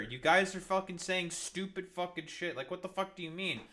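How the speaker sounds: ordinary delivery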